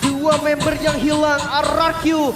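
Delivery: rising hyped caster call; fighting game announcer. Real, not acted. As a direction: push the energy as the push begins. Live play-by-play casting.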